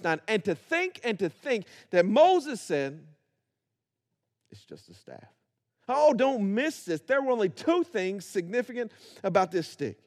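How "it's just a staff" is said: whisper. Retold or imitated. Moses dismissing his staff.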